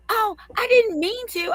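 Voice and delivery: high, whiny mock apology; mocking old teacher. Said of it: "She goes".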